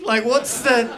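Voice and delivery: mock-offended, voice jumping up; rising indignant what's-the; indignant outburst. Indignant at teacher's sarcasm.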